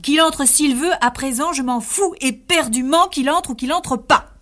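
high, loud furious rant; he has lost all patience and shouts it; angry outburst. Acted. Mariano rages at Zulma. (Mariano crie sa colère à Zulma)

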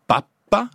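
papa accent sur la première syllabe et p double; pappa italien. Shown as a demonstration. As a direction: appuyez le début de papa et tenez le p. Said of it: "avec l'accent au début et deux P". (papa dit pàppa, p géminé)

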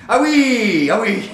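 high, loud ah of remembering; sudden recollection. Acted. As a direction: burst out as it comes back.

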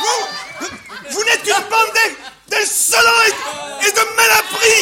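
high, slow, indignant sputtering; outraged. Acted. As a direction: splutter the insult, scandalized and high-pitched. Outraged bourgeois insulting the students.

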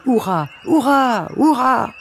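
high, drawn-out hurrahs; cheering. A narrator's reading. Crowd of birds cheering the duck, jubilant. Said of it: "les oiseaux poussèrent des".

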